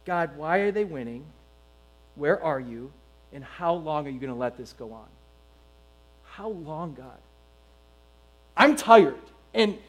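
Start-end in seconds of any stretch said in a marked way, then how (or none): 0.1-7.2 weary lament to God. Retold or imitated. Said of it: "We say this".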